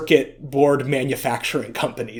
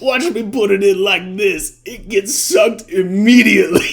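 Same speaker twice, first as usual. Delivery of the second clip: higher, louder mock copy of the ad accent; imitating Tony's odd accent; 4 semitones higher, 5 dB louder than usual. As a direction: parrot the strange accent through a grin. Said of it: "what accent is that that he's trying to do".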